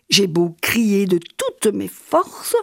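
ordinary delivery

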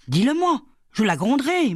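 high, urgent demand; worked-up protective scolding; indignant insistence. A narrator's reading. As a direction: press the child to tell, indignantly. Indignant guardian demands an answer.

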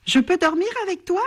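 quick, high little bat voice; murmured plea. A narrator's reading. Bat asks his friend to sleep with him, murmuring. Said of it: "il murmure à son ami".

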